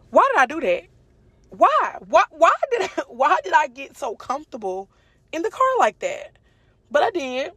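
high, incredulous self-scolding; exasperated why. Real, not acted. Scolding herself over the crash.

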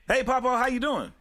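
to someone hard of hearing. Retold or imitated. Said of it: "this is what it is".

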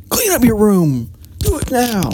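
high, drawn-out parental command; bossy parent order. Acted. Parent ordering kids to tidy their room, bossy high command. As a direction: order them around, pitched well up. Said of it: "order them around".

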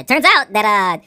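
imitated reviewer. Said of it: "and he goes".

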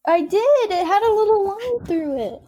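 high-pitched insistent protest; defensive protest. Real, not acted. Arguing with her friend about the mute button, high insistent protest. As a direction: insist you did it, voice up high.

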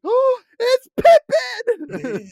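drawn-out, high, laughing shout of delight; excited recognition. Retold or imitated. Host reliving the moment she spotted Pippin's actor, squealing. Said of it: "I was like".

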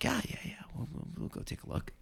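breathy whisper, hushed so the moose won't hear; whispered reply. Retold or imitated. Answering the cousins while hunting, whispering. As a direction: whisper it like you're sneaking up. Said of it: "So I'm like".

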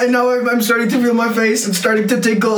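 in real pain from the heat; mouth burning from spicy food. Real, not acted. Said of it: "it's burning everywhere".

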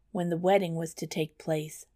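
ordinary delivery

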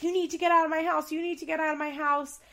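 angry mock yell; retold screaming. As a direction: yell him out of the house. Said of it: "he's screaming at her".

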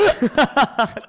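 surprised laugh. Real, not acted. Reacting to joke.

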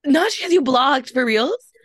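can't believe what she just heard; shocked disbelief. Real, not acted. Hearing her friend got blocked, shocked disbelief.

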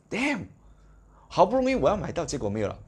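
retold exasperation at missing out; frustrated curse. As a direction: vent like a let-down fan. Retells frustration at sold-out copy.

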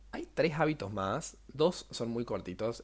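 normal talking voice

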